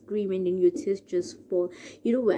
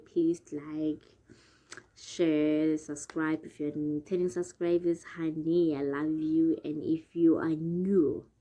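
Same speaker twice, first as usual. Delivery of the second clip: ordinary talk, nothing marked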